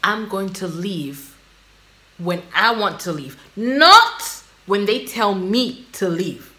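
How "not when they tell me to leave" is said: not punched, slow and deliberate; stress on not. Real, not acted. Firm reply to the walk-off clip, not stressed. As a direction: lean hard on not, taking your time. Stresses her own choice to leave.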